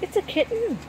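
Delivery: high gasping surprise, hushed; surprised whisper. A narrator's reading. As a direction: discover the kitten with a hushed gasp. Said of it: "I whisper".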